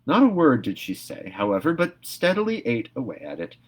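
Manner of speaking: plain reading voice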